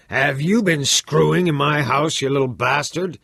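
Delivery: high, loud, furious bellow; a father roaring at his son. Acted. Father bellows at his son about his house, raging.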